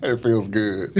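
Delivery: ordinary delivery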